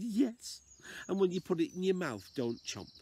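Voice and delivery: put-on a wise old lady advising, sing-song. Old lady tells the man to swallow a spider, high voice. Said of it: "my best old lady impression".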